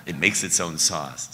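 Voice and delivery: loud ad slogan read; commercial voice. Retold or imitated. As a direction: pitch it like a TV ad. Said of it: "like the Gravy Train commercial".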